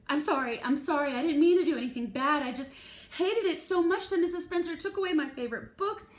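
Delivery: high, loud, tumbling panicked apology; frantic pleading. Pretend. Girl pleading with principal not to suspend her, frantic and loud. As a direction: beg the principal all in one desperate rush. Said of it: "what I was too scared to say".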